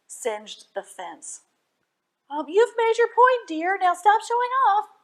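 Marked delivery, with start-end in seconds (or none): 2.3-4.9 high, loud scolding mother; mother scolding her showing-off husband. Acted. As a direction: tell him off, rising alarm. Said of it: "she scolded".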